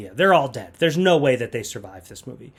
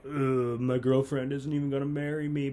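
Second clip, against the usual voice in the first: softer, mopey imitated boyfriend; mock dejection; 6 dB quieter than usual. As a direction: sulk like a rejected suitor. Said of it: "and he's like".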